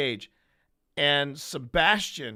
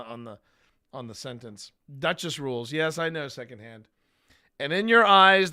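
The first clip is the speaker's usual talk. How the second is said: ordinary talk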